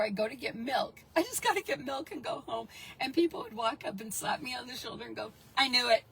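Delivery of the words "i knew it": loud knowing blurt; loud mock gloat. Neighbour at the grocery store, loud smug blurt. Townspeople slapping her shoulder.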